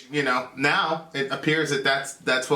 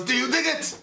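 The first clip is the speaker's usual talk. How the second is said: high, put-on stage call; 9 semitones higher than usual. Singer asking the crowd, mock stage voice. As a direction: imitate a frontman working the crowd. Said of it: "And he goes".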